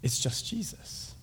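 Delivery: soft, slow, almost whispered; hushed realization. Acted. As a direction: drop to a hush after the commotion. Quiet answer after frantic search.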